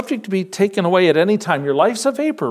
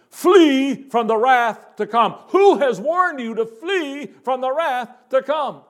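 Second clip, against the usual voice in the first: high, ringing preacher's call; urgent prophetic cry; 9 semitones higher than usual. Acted. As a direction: cry out a warning to the crowd. Preacher voices John warning the crowd.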